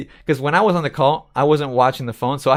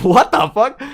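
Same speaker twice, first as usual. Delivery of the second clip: retold shock; 8 semitones higher, 7 dB louder, slower than usual. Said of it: "I was like".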